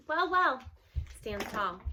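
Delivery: ordinary delivery, nothing marked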